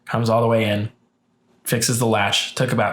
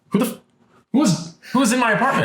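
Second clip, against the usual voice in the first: higher, fast, alarmed disbelief; retold disbelief; 10 semitones higher, faster than usual. Telling her friend how she reacted to the stranger, shocked and higher. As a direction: sputter it out in shock.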